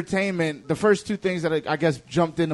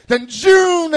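high, loud whoosh "joom"; imitated zoom noise; 9 semitones higher, 6 dB louder than usual. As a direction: shoot off with a zooming noise. Imitating characters zooming away.

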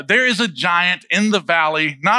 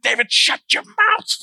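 much higher, slow mocking rebuke; exasperated older brother. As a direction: cut the kid off sharply. Brothers silencing David.

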